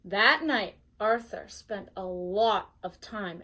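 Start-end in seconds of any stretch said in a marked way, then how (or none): none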